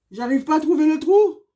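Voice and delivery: loud exasperated complaint; frustrated. Real, not acted. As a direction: vent it, fed up. Frustrated, earring won't go in.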